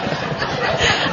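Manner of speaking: airless silent laugh; a short voiceless giggle; laughing quietly to herself; breathy giggle. Real, not acted. Laughing at crying husband.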